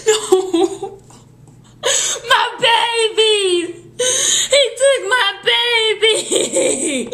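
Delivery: hammed-up slow wailing, high, laughing through; mock wailing. Grieving a lost game baby in hammed-up wails. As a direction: wail it like fake tragedy, giggling.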